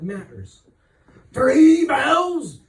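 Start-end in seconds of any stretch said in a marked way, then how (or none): 1.3-2.6 loud high howl of an order. A narrator's reading. Ship captain howling an order at his crew, loud. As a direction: bellow it at the crew like a madman. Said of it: "he would howl so loudly".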